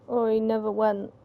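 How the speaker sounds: ordinary talk, nothing marked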